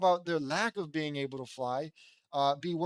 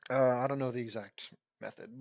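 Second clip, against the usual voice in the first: usual speaking voice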